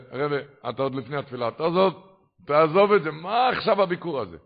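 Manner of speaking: fast, then loud pleading cry to stop; urgent protest. Retold or imitated. Gabbai begs the rebbe not to go, rising to a loud plea. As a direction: beg the rebbe to drop it, voice rising.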